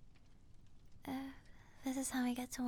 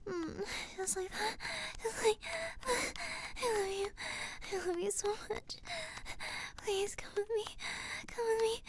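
mounting breathy urgency, pitch raised; breathless pleading; 5 semitones higher than usual. Acted. Mounting passion in intimate scene.

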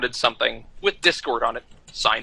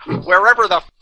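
louder, higher, slowing at the end; mock exasperation; 6 semitones higher, 4 dB louder than usual. Fan answering where to sign, exasperated and amused. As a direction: give up in comic exasperation, breaking toward laughter.

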